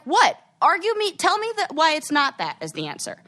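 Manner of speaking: high-pitched combative challenge, starting loud; fired up. Acted. Challenging classmates as she should have, fired up. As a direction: dare them to argue, voice pitched right up. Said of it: "now I'm all fired up".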